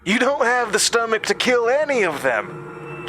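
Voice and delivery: high, contemptuous voice; cold sneer. A narrator's reading. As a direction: sneer down at her. Summers sneering.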